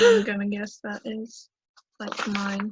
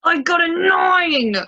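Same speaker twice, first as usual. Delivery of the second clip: higher, louder, slow cry of dismay; dismayed shout; 7 semitones higher, 5 dB louder, much slower than usual. Real, not acted. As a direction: groan it out loud. Misses the roll by one.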